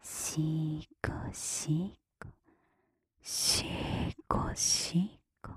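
very drawn-out whispered syllables; slow. Acted. Telling the listener it stays slow, drawn-out whisper. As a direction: stretch each whispered syllable out slowly. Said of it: "ゆっくりのまま".

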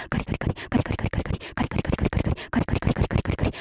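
very fast whispered "カリカリ"; rapid repetition. Acted. Older-sister figure speeding up for the listener, rapid-fire whisper. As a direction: rattle off "カリ" without a break. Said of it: "素早い".

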